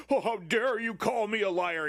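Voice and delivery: a light whiny, indignant mock of the Republican. Streamer mocking a Republican pundit on CNN, in a light whiny voice. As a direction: pitch it up a little and sound offended. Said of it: "the Republican was all like".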